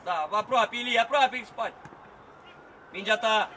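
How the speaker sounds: loud instructions to a player; coaching shout. Real, not acted. Coach guiding Ilie during play, loud shout. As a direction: project the directions across the field.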